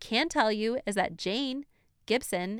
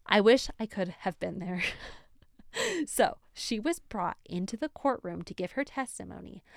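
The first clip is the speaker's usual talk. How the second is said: ordinary delivery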